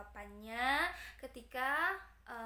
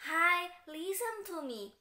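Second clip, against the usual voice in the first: louder, slow, higher Hi listen to me; teacher calling for attention; 5 semitones higher, 8 dB louder, much slower than usual. Shown as a demonstration. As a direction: grab the class's attention. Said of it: "kita bisa menggunakan".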